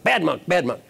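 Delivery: hammed-up grumbling reproach; angry monk. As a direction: keep nagging as the indignant monk. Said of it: "his colleague is still angry".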